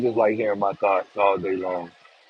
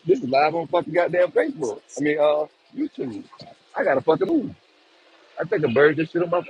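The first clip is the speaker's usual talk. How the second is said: ordinary delivery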